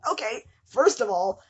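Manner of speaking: usual speaking voice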